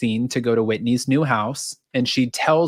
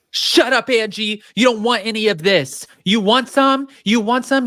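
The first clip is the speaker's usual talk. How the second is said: mock angry yell; 7 semitones higher than usual. [name] stands up, yelling at Angie.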